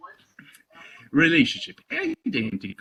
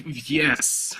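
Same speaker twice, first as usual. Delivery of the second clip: ordinary delivery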